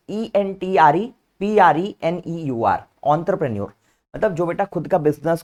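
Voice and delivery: ordinary delivery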